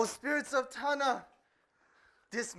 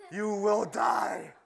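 menacing witch doctor; slower than usual. Acted. Witch doctor threatens the missionary.

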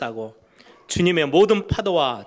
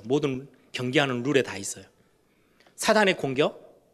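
usual speaking voice

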